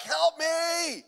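high, hammed-up plea for rescue; playful fake distress call; mock cry for help. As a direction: beg to be let out, jokingly. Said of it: "I bang on the window like".

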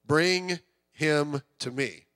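slow, low and tender; Jesus calling the boy over kindly; gentle invitation. Retold or imitated. Pastor quoting Jesus gently to the congregation, low and slow. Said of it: "Here's the next phrase".

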